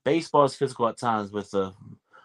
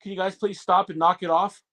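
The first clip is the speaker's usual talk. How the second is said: mock pleading referee; 7 semitones higher than usual. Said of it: "I heard the audio".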